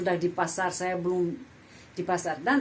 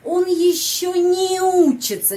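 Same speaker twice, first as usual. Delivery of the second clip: "не учится" joined, much higher and louder, slow; linked не; 9 semitones higher, 7 dB louder than usual. Shown as a demonstration. Teacher shows не joined to учится. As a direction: slide "не" into "учится". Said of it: "не sama учится sambung".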